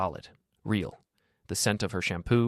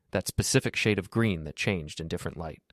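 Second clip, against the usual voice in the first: usual speaking voice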